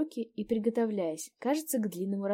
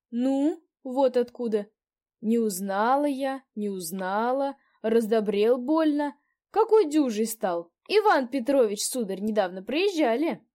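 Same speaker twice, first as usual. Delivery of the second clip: put-on old landlady; 4 semitones higher, 9 dB louder, slower than usual. A narrator's reading. Landlady chatting.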